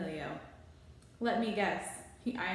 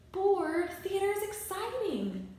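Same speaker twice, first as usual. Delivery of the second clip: put-on high, louder, offended kid; indignant Rollo; 11 semitones higher, 3 dB louder than usual. A narrator's reading. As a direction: protest like a theater-mad boy. Rollo defends theater.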